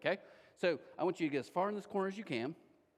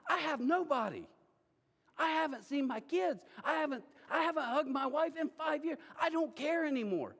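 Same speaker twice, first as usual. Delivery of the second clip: higher, pleading and desperate; desperate plea; 7 semitones higher than usual. Pretend. Leper tells his friends why he must go, desperate plea. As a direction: beg like you've lost everything.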